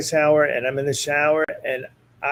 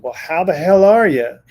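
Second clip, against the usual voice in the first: booming uncle greeting, louder; loud obnoxious uncle; 4 semitones higher, 9 dB louder than usual. Retold or imitated. Medium voicing the dead uncle greeting her client loudly. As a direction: hail him like a rowdy uncle. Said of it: "really loud, obnoxious".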